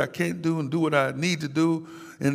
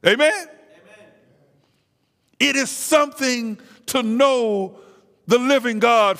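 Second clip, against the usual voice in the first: preaching at full force; 4 semitones higher than usual. Real, not acted. Preacher drives the congregation toward deliverance, full-force preaching. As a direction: build it up like a revival climax.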